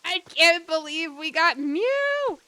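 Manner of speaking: loud, slow, stretched disbelief; real excitement. Real, not acted. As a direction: savour the win. Caught Mew.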